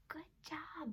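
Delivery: soft whispered good job; whispered praise. Acted. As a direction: breathe the praise softly.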